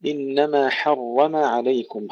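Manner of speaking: حرم with a heavy, full R and a light M. Shown as a demonstration. Teacher demonstrates the verse for students, heavy R in حرم. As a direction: say حرم with a thick R. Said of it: "حرم تفخيم الراء ترقيق الميم".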